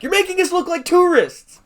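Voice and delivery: an exasperated teacher finally snapping. Retold or imitated. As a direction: lose it at the students. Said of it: "she just snapped".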